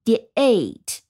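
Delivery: "immediate" ending in a full "di-ATE", like the letter A; stressed -ate ending. Shown as a demonstration. Teacher showing how a stressed ending would sound, full "ATE" in "immediate". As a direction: say the end of "immediate" as stressed "AYT". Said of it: "它其实应该读成".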